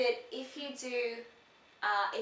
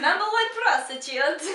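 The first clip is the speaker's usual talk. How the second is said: loud, high mock Kazakhstani accent, laughing; hammed-up character voice; Borat; 7 semitones higher, 8 dB louder than usual. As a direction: proudly proclaim it in a comic foreign accent. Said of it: "like Borat".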